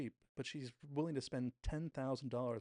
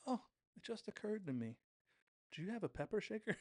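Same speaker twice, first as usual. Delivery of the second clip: high-pitched, fake-casual shopper; imitated airy pretend afterthought; playing a role; 10 semitones higher than usual. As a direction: put on a high voice as if it just occurred to you. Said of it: "sick of playing this role".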